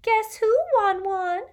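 very high, loud singsong tease; trilled. A narrator's reading. Lavender creeping up behind Ron to surprise him, trilled. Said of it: "and trilled".

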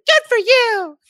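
very high, loud cartoon squeal; mock cheering. Kenny cheering Satan on, high mock squeal. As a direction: squeal the encouragement.

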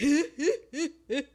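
loud, honking mock laugh; put-on chuckle in a row of bursts; Eddie Murphy laugh. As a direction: imitate a famous comic's signature laugh. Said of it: "Eddie Murphy's doing his Eddie Murphy laugh".

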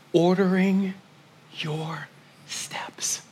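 barely voiced, intimate hush; intimate whisper. Pastor whispering hope to his grieving family. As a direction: lean in and whisper the promise. Hushed sermon climax.

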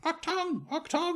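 high squawking bird call. Acted.

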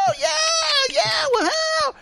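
very high shrieked mock cheering; mock crowd cheer. Host mimics over-excited audience, high whooping cheer. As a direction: imitate a studio audience going wild. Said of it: "And everyone's like".

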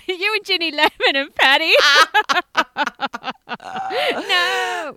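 high, loud laughing through words. Real, not acted. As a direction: giggle through the tease.

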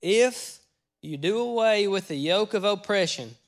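emphatic preacher reading scripture aloud. Real, not acted.

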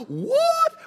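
sharp gasp, then a high, loud "what"; mock shock from the crowd, shrill-high and loud; shocked listener. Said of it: "Now, some of y'all going".

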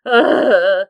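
loud groan of dismay; pained groan. Real, not acted. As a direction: groan heavily at how sad it is. Groan over sad scene.

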